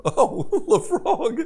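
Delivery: high, loud, helpless laughter; laughing. Real, not acted. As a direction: squeal the words out through laughter. Game master laughs at repeated target.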